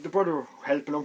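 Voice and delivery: ordinary delivery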